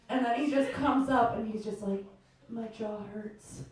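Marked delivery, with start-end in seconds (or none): 2.5-3.6 mopey complaint. Retold or imitated. Date's tired complaint after thirty seconds.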